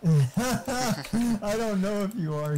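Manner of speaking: laughing through the words, drawn out slowly. Real, not acted. Friend teasing about his PC upgrade, laughing. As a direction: chuckle it out at your friend's expense.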